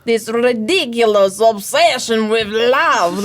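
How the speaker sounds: slow, heavy Russian accent, lightly hammed; mock Russian accent. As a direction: do the father in a Russian accent, dragged out. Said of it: "That's how he speaks".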